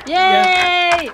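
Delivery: excited cheer. Real, not acted. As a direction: celebrate the big win. Cheering the damage total.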